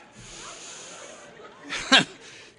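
high airy laugh. Real, not acted. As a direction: let out a breathy laugh. Laugh alone on line.

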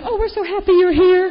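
gushing welcome. Retold or imitated. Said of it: "those people say".

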